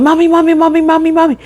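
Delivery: put-on high, eager child's voice; a small kid tugging for attention; imitating child calling. Child calling mommy over and over.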